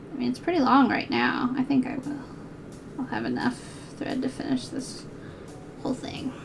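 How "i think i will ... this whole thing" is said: quiet self-reassurance; hushed murmur; muttered to herself. Real, not acted. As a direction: talk yourself through it softly. Muttering to herself while sewing.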